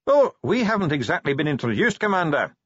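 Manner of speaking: cheerful high dwarf voice. Acted. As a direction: greet the commander cheerfully, pitched well up. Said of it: "said the dwarf cheerfully".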